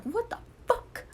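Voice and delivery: high, startled what the fuck; shocked. Retold or imitated. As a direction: yelp it in disbelief. Shocked doctor quote.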